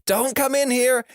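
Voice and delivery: high-pitched yelled warning, acted out; high play-acted cry of alarm; mock yell. A narrator's reading. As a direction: call out high, warning friends away. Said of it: "with him yelling".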